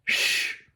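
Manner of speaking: ch hissed softly with no voice; ch sound. Shown as a demonstration. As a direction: hold a quiet ch hiss. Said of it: "qui font le son". (le son ch chuchoté)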